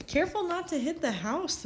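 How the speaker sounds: lightly voiced dad calling a warning; father calling out. Father warns flying daughter, mild calling voice. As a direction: call up to her like a worried dad. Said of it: "Violet's father would yell".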